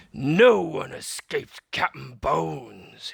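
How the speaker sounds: loud, slow pirate captain boast; pirate captain roaring at his captives. A narrator's reading. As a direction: roar it like a menacing captain. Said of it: "he roared".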